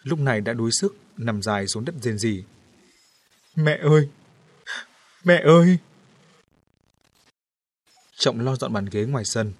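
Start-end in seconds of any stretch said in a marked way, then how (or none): 3.5-5.8 loud, worn-out cry for mother; exhausted wailing. A narrator's reading. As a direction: wail hoarse after hours of crying. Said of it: "kêu gào khàn tiếng". (gào khóc khàn tiếng gọi mẹ)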